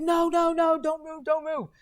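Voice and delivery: very high imitated alarm, pleading; retold frantic no no no; panicked warning. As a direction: mimic the rescuers begging him to freeze. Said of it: "They were like".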